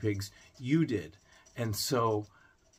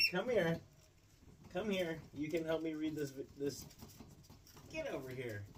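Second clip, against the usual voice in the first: coaxing pet; 6 semitones higher, 9 dB quieter than usual. Real, not acted. Vlogger coaxing his guinea pig to help read, softer coaxing. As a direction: gently beckon the little animal closer.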